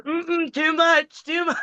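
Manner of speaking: high-pitched mock recoil, hammed up; squeamish protest. To Tracy after the kiss, a high mock squirm. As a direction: squeal it like you're squirming away. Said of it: "you see me on camera going".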